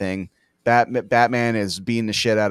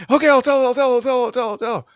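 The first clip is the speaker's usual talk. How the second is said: very high, squealing mock panic; mock panicked surrender; 12 semitones higher, faster than usual. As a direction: imitate a victim caving instantly. Mimics torture victims caving in.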